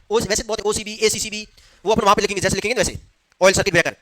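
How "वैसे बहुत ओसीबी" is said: usual speaking voice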